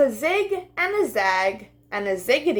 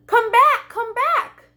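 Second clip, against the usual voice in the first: high, loud calling after him; crying out; 12 semitones higher, 5 dB louder, slower than usual. Acted. Lamb calling after the leaving butterfly, high and loud. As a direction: call out high as he flies away. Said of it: "she cried".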